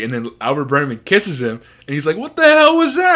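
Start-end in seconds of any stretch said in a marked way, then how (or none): 2.2-3.1 high, loud outraged yell; shocked yell. Retold or imitated. Albert yelling at Hitch after the kiss, high shocked shout. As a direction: shout it in disbelief.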